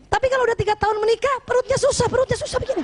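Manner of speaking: fast, then squeeze it out as if the belly's in the way; wife mocking a husband too stout to lean over; paunchy husband.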